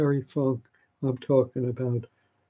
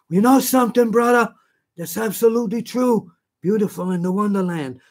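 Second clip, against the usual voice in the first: much higher, louder wrestler promo; Superfly Snuka voice; 8 semitones higher, 3 dB louder than usual. Retold or imitated. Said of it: "Superfly brother".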